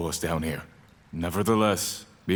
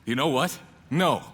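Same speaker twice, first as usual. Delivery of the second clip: higher, cocky no; defiant refusal; 7 semitones higher than usual. Acted. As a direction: refuse with bravado. Sam defies the villain.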